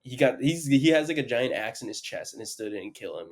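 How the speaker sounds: ordinary delivery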